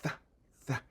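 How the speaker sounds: "th" with tongue between teeth; isolated soft th; th sound alone. Shown as a demonstration. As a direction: model the th. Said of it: "n ap eseye pou nou pwononse l".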